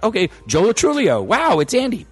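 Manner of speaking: amused retelling of repeated surprise; mock surprise. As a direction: replay the moment he kept spotting Andy again. Retelling his own surprised reaction.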